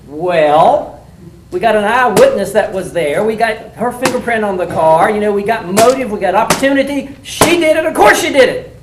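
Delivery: loud, building, insistent cop retort; mock explosive answer. Cop firing back at the defense attorney, loud and building. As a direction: blast the defense with the evidence, building to a yell. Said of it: "I was expecting you to say".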